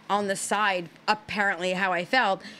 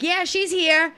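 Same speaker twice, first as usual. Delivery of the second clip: mom calling out, high and louder; imitated mom; 9 semitones higher, 5 dB louder, slower than usual. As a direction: raise pitch and volume as mom on the phone. Mom answering his worried call.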